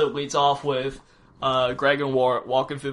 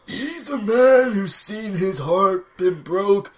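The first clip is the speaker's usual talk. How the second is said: Woodsman voice; 8 semitones higher, 11 dB louder than usual. Acted. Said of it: "That is Woodman, Woodsman voice".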